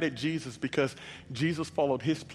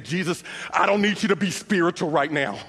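louder and higher, exasperated; frustrated; 9 semitones higher, 9 dB louder than usual. Acted. Sister rebukes Jesus for preaching at her, frustrated. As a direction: snap at him to stop being spiritual. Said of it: "Martha gets even more frustrated".

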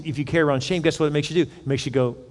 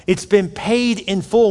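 louder, higher, slow declaiming sermon voice; full-force preaching; 6 semitones higher, 4 dB louder, slower than usual. Acted. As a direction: proclaim victory to the congregation. Preaching to congregation.

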